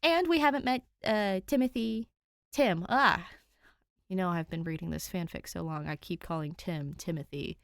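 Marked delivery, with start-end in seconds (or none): none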